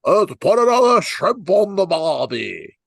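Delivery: high, loud, hammed-up Australian accent; botched Australian accent sliding toward pirate; bad Australian accent. Shown as a demonstration. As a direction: put on an over-the-top Australian accent. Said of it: "my Australian accent is, like, unbelievable".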